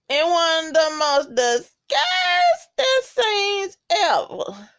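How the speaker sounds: rising high and drawn out on scenes ever; mock-dramatic announcer build. Real, not acted. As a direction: stretch it out like a big reveal. Recapping a gross scene, dramatic build.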